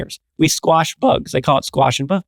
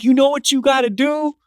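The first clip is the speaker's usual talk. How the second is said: big customer scolding the cashier, hammed-up and raised high; lecturing big dude; 10 semitones higher than usual. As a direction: lay down the rules to the kid, exasperated. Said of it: "He's like".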